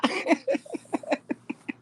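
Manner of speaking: long, high chuckle trailing off; soft run of high laughter. Real, not acted. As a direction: giggle at your own silliness. Amused after the cha-cha count.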